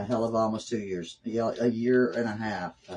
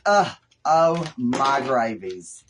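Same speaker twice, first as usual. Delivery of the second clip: slow, loud alarmed groan of surprise; drawn-out dismay; 6 dB louder, much slower than usual. Real, not acted. Crafter watching the resin go wrong, slow loud dismay. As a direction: stretch it out as something goes wrong. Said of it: "[name] has flipped her lid".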